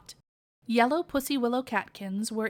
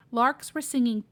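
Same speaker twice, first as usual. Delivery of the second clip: ordinary delivery, nothing marked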